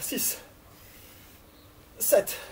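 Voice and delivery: ordinary talk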